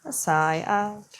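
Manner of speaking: teacher sighing audibly as she cues the out-breath. Shown as a demonstration. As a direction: let the words ride out on a sigh. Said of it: "tone in chanting one Om".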